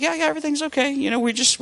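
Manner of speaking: a lightly voiced, too-bright brush-off to calm the nurse; imitating himself, high and chirpy; overly cheery reassurance. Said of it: "I'm like".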